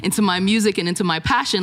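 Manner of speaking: ordinary talk, nothing marked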